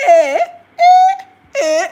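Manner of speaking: high, loud hooting "eh"; hooting Ghanaian sisters. Retold or imitated. Imitating family sisters hooting loudly with laughter. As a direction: holler it like laughing aunties.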